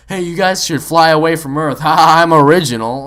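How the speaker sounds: loud, goofy self-satisfied mimicry; mocking player impression. Storyteller mimicking the player's song joke, loud mockery. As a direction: imitate a bad joker laughing at himself.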